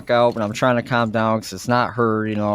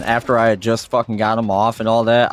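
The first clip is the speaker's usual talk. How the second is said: ordinary delivery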